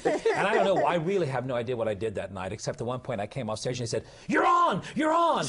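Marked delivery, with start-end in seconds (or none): 4.3-5.5 loud, high-pitched mock yell; urgent shouted cue. Someone backstage sending the actor on stage, urgent shout.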